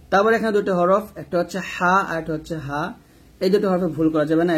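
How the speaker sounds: ordinary talk, nothing marked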